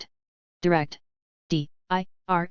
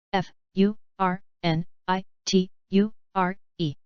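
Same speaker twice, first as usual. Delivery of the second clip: slow, letter by letter; unhurried classroom spelling. Shown as a demonstration. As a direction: spell each letter out slowly. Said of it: "spelling drill, slow then fast".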